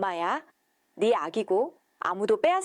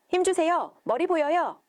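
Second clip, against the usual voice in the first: raised, higher calls of a doctor in the delivery room; doctor calls to the mother in labour, raised and higher; doctor shouting; 6 semitones higher than usual. A narrator's reading. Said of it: "의사 선생님이 소리쳤습니다".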